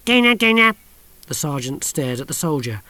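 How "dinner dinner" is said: put-on high, loud old crone singsong; mad old woman. A narrator's reading. Mrs Tachyon calling to the soldiers, high singsong. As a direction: chirp it like a batty old lady.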